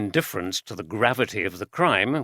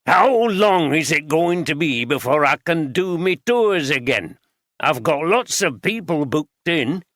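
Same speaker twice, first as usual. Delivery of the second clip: higher, louder broad Yorkshire voice; an old Yorkshireman barges in, broad Yorkshire accent; 5 semitones higher, 5 dB louder than usual. A narrator's reading. Voiced character Duffield.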